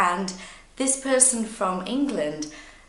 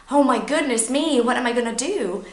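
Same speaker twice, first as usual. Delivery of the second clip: higher, louder flustered cry; mock panic; 6 semitones higher, 5 dB louder, faster than usual. Nervous learner in a hectic moment, mock panic. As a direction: panic theatrically, rushing toward the end. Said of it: "it's a really hectic situation".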